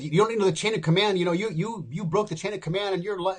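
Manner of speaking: fast, loud angry rant; livid commander. Retold or imitated. Base commander berating the young airman, fast angry outburst. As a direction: fire it off like a furious officer.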